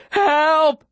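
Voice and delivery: high desperate yell; shouting for help. A narrator's reading. Boy carrying a wounded girl into town, desperate shout. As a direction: cry out high for anyone to hear.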